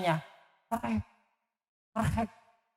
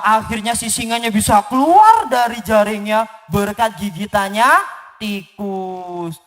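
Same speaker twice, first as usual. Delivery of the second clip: ordinary delivery, nothing marked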